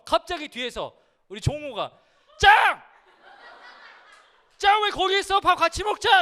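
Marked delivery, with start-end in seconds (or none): 2.4-6.2 loud, high excited shout across the room; a loud eager call from far off; calling out loudly. Retold or imitated. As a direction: yell out eagerly to a friend. Friend calling out across cafeteria.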